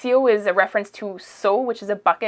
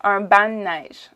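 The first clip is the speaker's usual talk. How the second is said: Quebec accent demo. Shown as a demonstration. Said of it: "I'm saying it à la québécoise".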